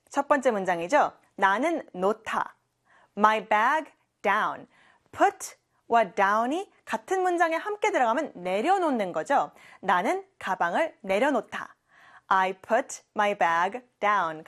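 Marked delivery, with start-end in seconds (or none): 3.2-4.7 "My bag down" drawn out word by word; very slow. Shown as a demonstration. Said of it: "직접 문장을 만들어 볼까요". 12.3-14.5 "I put my bag down" said word by word; very slow model. Shown as a demonstration. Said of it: "같이 해볼까요".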